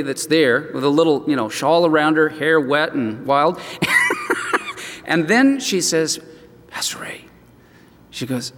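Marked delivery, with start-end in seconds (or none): 3.6-5.0 light laugh. Real, not acted. Laughing at the story. 6.7-7.2 soft unvoiced whisper of his name. Hairdresser whispering to the pastor about the widow, hushed whisper.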